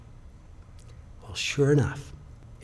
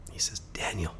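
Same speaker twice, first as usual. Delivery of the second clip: whispered, softer and lower; the boy quietly asking his teacher in front of the cameras; hushed question; 4 semitones lower, 7 dB quieter than usual. Retold or imitated. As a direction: hold the room in a whisper.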